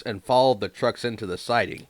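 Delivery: ordinary delivery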